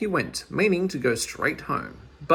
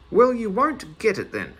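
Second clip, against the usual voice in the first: higher, louder defiant shout; shouting back; 7 semitones higher, 5 dB louder than usual. Acted. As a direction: yell it over the shoulder. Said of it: "shouted back poor Meddle".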